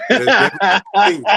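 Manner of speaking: loud laughing, words rushed out high. Real, not acted. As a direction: say it through a burst of laughter. Laughing at own joke.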